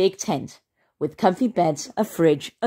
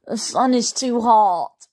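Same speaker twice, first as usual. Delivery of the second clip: slow, higher whining complaint; whiny Henry; 5 semitones higher, 4 dB louder, slower than usual. A narrator's reading. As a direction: whine it like a spoiled boy. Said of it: "Henry whined".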